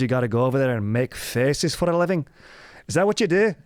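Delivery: high, scolding mock-Scottish rant; Scottish accent. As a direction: heckle in a hammed-up Scottish brogue. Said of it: "that's how you say actor in Scottish".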